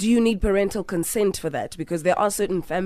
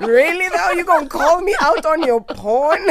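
high, loud, laughing disbelief; outraged laughing protest; incredulous outburst; 10 semitones higher, 6 dB louder than usual. Retold or imitated. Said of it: "I was like".

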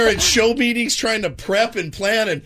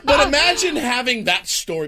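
usual speaking voice

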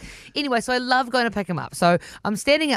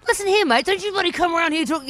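higher, stern Aussie telling-off; heated reenactment; 8 semitones higher than usual. Shown as a demonstration. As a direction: warn the kid off firmly. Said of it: "Can I just have a reenactment".

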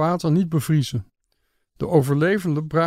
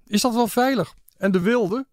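higher-pitched, worried questions; anxious calling out; 7 semitones higher than usual. A narrator's reading. Guests calling out questions to their guide, raised and anxious. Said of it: "Mijn gasten begonnen door elkaar te roepen".

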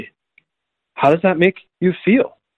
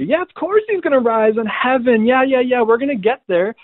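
high-pitched, dismissive mock agreement; 10 semitones higher than usual. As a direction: rattle off the dutiful answer in a high voice.

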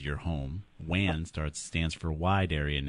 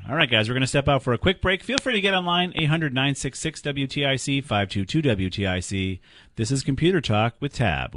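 higher, louder announcer patter into the break; radio host sign-off; 6 semitones higher, 5 dB louder than usual. Real, not acted. Radio host sends listeners to a break, upbeat announcer delivery. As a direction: punch out the call-in numbers, raised and brisk at first.

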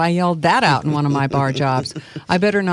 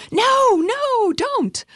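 very high, thin scream; screaming inner voice; much slower than usual. Acted. Inner voice fights the prayer, high acted scream. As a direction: cry out in high-pitched panic. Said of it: "I heard this voice screaming inside of me".

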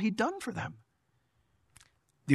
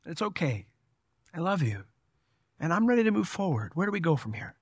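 softer, gentle turn to forgiveness; reassuring forgiveness; 4 dB quieter than usual. Retold or imitated. Pastor voicing forgiveness to someone who wronged him, softly reassuring. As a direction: drop the voice and offer peace. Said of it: "I go to people all the time and I say".

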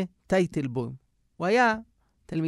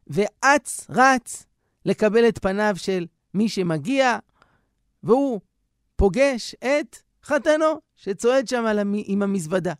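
ordinary talk

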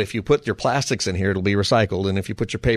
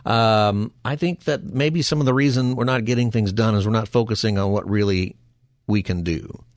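ordinary delivery

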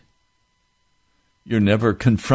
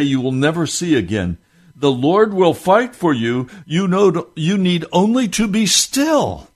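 preached scripture reading; 4 semitones higher, 4 dB louder than usual. Acted. Preacher reading Moses to the congregation, proclaiming. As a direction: proclaim the verse to the people.

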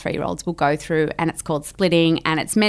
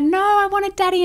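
put-on whiny child; 13 semitones higher than usual. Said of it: "they might be like".

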